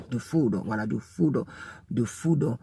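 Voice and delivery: food with a long drawn oo. Shown as a demonstration. Teacher drawing out the oo of food for learners. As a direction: stretch the oo in food. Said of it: "Il faut durer sur le ou de food".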